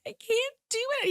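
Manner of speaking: high-pitched mock kid meltdown; imitating frustrated child. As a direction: whine it like an upset little boy. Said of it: "he gets really frustrated".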